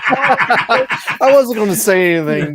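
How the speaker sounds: high, loud words through laughter; laughing. Real, not acted. Co-host admitting he noticed the slip, laughing. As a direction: say it cracking up, drawn out.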